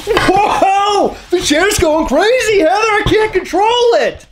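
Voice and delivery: high, excited whoa; yelping as the chair spins; excited yelling. Real, not acted. Chair spinning out of control.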